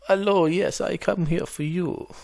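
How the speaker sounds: high, loud mock Russian girl accent. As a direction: purr it like a cheeky Russian girl. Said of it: "as, like, a Russian girl".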